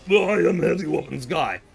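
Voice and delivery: bad big-gunner game impression; Heavy. Streamer doing rapid-fire impressions, Heavy voice. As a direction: put on the gunner's voice, firmly announced. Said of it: "Here are my shitty impressions".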